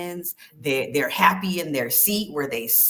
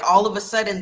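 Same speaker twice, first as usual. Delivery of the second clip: normal talking voice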